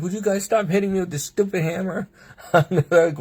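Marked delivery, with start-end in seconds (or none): 0.0-2.1 high-pitched fed-up plea; exasperated protest. Retold or imitated. Patient to doctors hitting her with a hammer, exasperated. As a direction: complain in a high, pestered voice.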